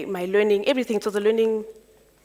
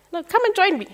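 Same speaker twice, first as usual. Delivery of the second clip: repeated invitation pitched much higher, coaxing; insisting; 10 semitones higher than usual. Retold or imitated. Mentor repeating his job offer to her, insistent and higher.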